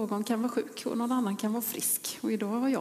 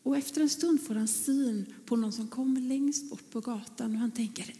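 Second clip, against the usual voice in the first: usual speaking voice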